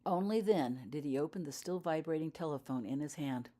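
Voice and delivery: usual speaking voice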